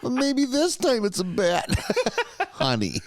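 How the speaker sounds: high, laughing mock-mom voice. Friend plays the mom teasing her scared son, high mock voice. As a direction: tease him as a knowing mom, high and amused.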